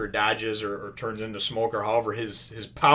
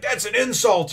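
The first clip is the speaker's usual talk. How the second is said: high, louder mock outrage; imitated angry yell; 10 semitones higher, 5 dB louder than usual. Sergeant yelling at the swordsman, imitated outrage. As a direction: yell it as an offended protest. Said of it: "so she's yelling at him".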